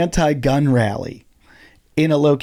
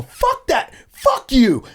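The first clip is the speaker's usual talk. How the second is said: retold yell; 12 semitones higher, slower than usual. Comic swearing at the puncher from stage, retold yell. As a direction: hurl it at the attacker. Yelling at attacker.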